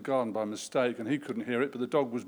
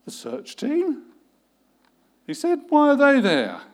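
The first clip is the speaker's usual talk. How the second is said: much higher, louder, stunned; shocked question; 12 semitones higher, 9 dB louder than usual. A narrator's reading. Boss shocked to hear of a search team. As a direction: gasp out the questions in disbelief.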